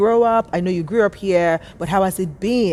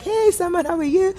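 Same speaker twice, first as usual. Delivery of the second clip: cheery greeting; 12 semitones higher than usual. Retold or imitated. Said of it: "And I was like".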